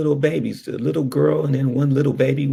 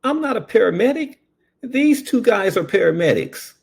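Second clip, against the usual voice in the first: dismissive deputy; 10 semitones higher than usual. Retold or imitated. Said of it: "He goes".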